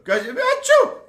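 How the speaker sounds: very high, loud cartoon sneeze; a big squeaky sick-sheep sneeze; sheep sneezing.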